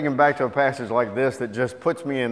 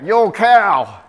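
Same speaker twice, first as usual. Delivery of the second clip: loud, high mock yell; yell back; 7 semitones higher, 11 dB louder than usual. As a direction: holler it back at the passing car. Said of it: "And he yelled back".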